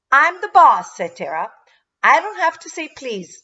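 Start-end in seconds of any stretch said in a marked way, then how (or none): 0.1-1.0 put-on high, loud bossy child; bossy retort. A narrator's reading. Tara answering Danielle's complaint, bossy and defiant. 2.0-3.4 put-on high, loud, defiant child; bossy retort. A narrator's reading. Tara refusing Danielle's demand for manners, bossy.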